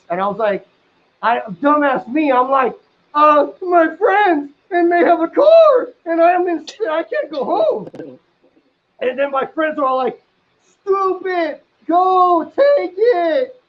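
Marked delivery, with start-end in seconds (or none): 3.1-7.9 panicky whine. Retold or imitated. Flustered excuse to the girl. 10.6-13.6 high, drawn-out mock yell across the room; hollering at a clueless friend, much higher; retold yell. Friends yelling at the narrator across the room, mock yell. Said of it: "yell that across the room".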